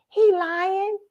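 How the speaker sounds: put-on high little girl accusation; child voice. Little girl telling grandpa the preacher lies, high. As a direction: pipe up like a small kid. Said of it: "I said".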